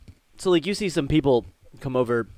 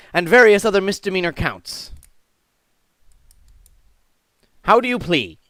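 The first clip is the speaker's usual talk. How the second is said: raised, formal charge-reading voice; stern court judge; 7 semitones higher, 5 dB louder than usual. Acted. Judge reading charges to the accused, formal proclamation.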